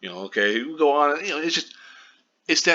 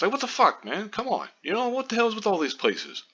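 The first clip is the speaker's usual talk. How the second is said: pitched way up in disbelief, half-shouting; exasperated outburst; 10 semitones higher than usual. Real, not acted. Vlogger fed up with media headlines, high exasperated outburst. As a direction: throw your hands up at the headlines, voice climbing high. Ranting at misleading news headlines.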